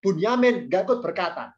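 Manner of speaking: ordinary talk, nothing marked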